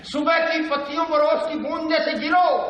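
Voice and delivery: high, declaimed verse; Bhavani Prasad Mishra. Acted. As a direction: send the lines out high to the crowd. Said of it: "बातचीत की शैली".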